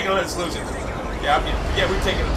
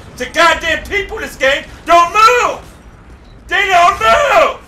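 exasperated shouting; 6 semitones higher, 4 dB louder than usual. Real, not acted. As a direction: yell in real exasperation at the other players. Gamer blames other players.

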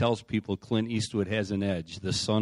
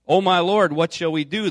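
high, loud cry of alarm, read aloud; alarmed plea; 9 semitones higher, 10 dB louder than usual. A narrator's reading. Servant sees the army round the city, alarmed plea read aloud. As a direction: narrate a servant's worried outburst. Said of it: "Don't be afraid".

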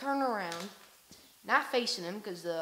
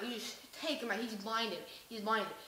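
usual speaking voice

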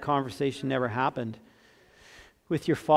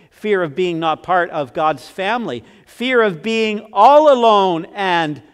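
preaching at full force; 4 semitones higher, 11 dB louder than usual. Acted. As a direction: hammer each fear home from the pulpit. Preacher builds on fear list.

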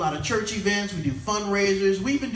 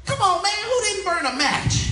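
mock disgusted cry; 12 semitones higher, 3 dB louder than usual. Coworker complains about bathroom smell.

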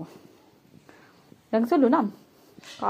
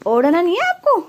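pet talk; 8 semitones higher, 5 dB louder than usual. Real, not acted. Teasing the pet under blanket.

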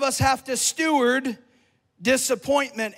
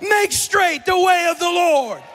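louder, higher, shouting climax; rally the church to stand; fiery preacher crescendo; preaching at full force; 5 semitones higher, 4 dB louder than usual. Acted.

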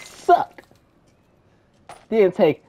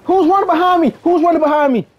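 higher, louder urgent call; alarmed shout; 10 semitones higher, 7 dB louder, faster than usual. Real, not acted. Gamer shouting about someone chasing him, alarmed yell.

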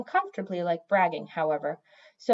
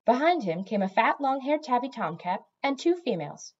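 usual speaking voice

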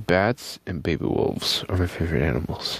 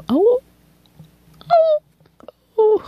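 very high, loud pup howl; howling like a wolf pup; 8 dB louder than usual. Retold or imitated. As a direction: howl like a baby wolf learning. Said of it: "they're learning to howl".

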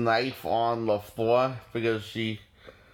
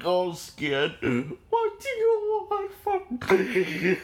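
high, shaky mock-terrified plea; mock scared whimper; 12 semitones higher, slower than usual. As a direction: ham up a frightened mother begging. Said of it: "she's all, like, coughing and shaking".

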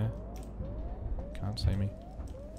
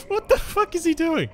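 high, squeaking alarm; startled disbelief; 3 dB louder than usual. Real, not acted. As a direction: blurt it out in high-pitched disbelief. Startled by threat in game.

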